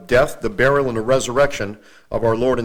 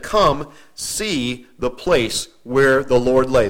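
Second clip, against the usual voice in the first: very slow, weighty; slow emphasis; much slower than usual. Shown as a demonstration. Preacher lingers on the angel's invitation for the congregation, slow. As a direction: linger on each word. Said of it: "dwelling on the verse".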